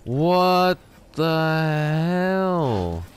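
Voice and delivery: extremely slow, long-drawn disbelief; stunned what the hell. Real, not acted. Gamer staring at the villain's hideout, stretched disbelief. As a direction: stretch every word out, dumbfounded. Finds strange room in game.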